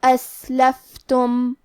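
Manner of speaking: اسلفتم very slow, breathy س and ف; whispered hams. Shown as a demonstration. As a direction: let air escape on the س and ف of اسلفتم. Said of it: "یہ کلمہ کو ایسے پڑھیں گے".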